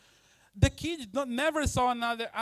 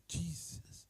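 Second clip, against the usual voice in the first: breathless whispered Jesus; awed whisper; 14 dB quieter than usual. Retold or imitated. A stranger sees the shining face, awed whisper. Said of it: "someone look at you and say".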